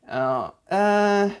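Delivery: high, reluctant turning down; hesitant eh. Shown as a demonstration. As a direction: hesitate with a drawn-out eh, then decline. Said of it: "like an E H in English".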